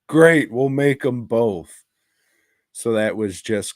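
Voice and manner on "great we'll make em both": slow, louder, higher studio boss voice; a hammed-up yes from the boss; mock eager producer. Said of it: "spat shit out on the fly".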